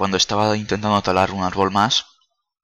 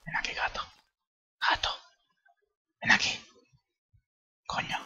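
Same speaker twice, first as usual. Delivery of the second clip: soft unvoiced whisper; whispered call to the cat; 8 dB quieter, faster than usual. Real, not acted. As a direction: whisper so the cat won't get scared. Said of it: "voy a hablar muy bajito".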